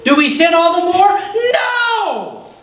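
very high ringing shout of No; Paul shouting. Retold or imitated. Pastor voicing Paul shouting at the sinners, high and loud. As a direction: cry out as if Paul himself is shouting. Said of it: "It is almost as if Paul is shouting".

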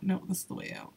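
softly whispered answer to herself. Real, not acted. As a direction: murmur it under your breath.

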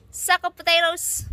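loud high playful call upward; shouting up to balcony. Real, not acted. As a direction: call up to him teasingly.

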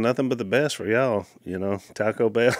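ordinary talk

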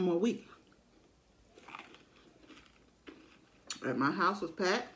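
chewing while talking; talking between bites; mouth full. Real, not acted. Seen 3: bites a piece of taco shell at 0.2, then chews while speaking.

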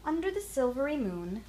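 ordinary delivery

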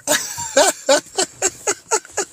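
squeaky high laugh cracking up; high giggle. Real, not acted. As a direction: let a high giggle burst out. Laughing at his own skit.

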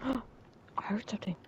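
scared slow whisper. Real, not acted. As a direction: breathe it out, frightened. Sneaking, gasp then whisper.

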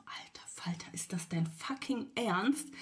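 hushed, slow stunned whisper; whispered inner outrage; whispered disbelief. Retold or imitated. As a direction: breathe it out in shock. Shocked inner thought, whispered.

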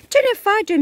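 pleading cry. A narrator's reading. Commander pleading for help.

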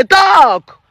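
a sharp, loud "Talk." pitched high; firm command. Retold or imitated. Mother urging her silent son, loud and firm. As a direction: order the son to speak up. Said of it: "I say".

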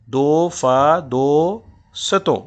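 "दो फा दो सतों" said very slowly, split into metric beats; scanning syllables. Shown as a demonstration. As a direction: stretch "दो सतों" into separate beats. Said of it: "एक और उसूल बयान होने जा रहा है". ("दो सतों" को रुक-रुक कर धीरे बोलना)

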